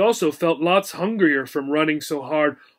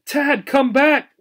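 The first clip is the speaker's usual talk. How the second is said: high, slow call to someone leaving; a hamster calling after the boy; 9 semitones higher, 4 dB louder, slower than usual. A narrator's reading. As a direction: call him back down the hall. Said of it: "she called out".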